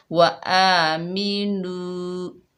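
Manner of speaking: slow chant of वा आ मी नू with नू held; slow Quranic recitation. Shown as a demonstration. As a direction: chant it slowly, holding the नू. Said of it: "एक अलिफ की मिकदार खींच कर पढ़ेंगे".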